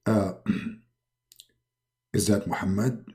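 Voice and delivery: low, soft godly caller; quiet, deep voice of authority; Allah. Acted. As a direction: speak low and hushed as a deity on the phone. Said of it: "and then Allah, he call him".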